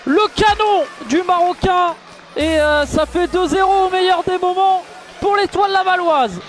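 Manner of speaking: high, ringing play-by-play call of a long-range goal; excited goal commentary. Acted. Commentator hails a stunning long-range strike, excited play-by-play.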